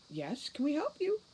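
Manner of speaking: high sing-song greeting; bright carefree lady; airy mother. Acted. Mad mother greets son brightly.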